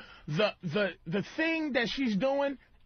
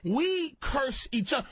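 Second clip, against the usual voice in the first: usual speaking voice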